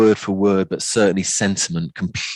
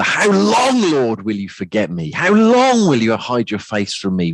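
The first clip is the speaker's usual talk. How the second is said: high, frustrated cry on a stretched LONG; anguished, frustrated outcry to God; desperate shout; 5 semitones higher than usual. Shown as a demonstration. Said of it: "a real sense of shout and desperation".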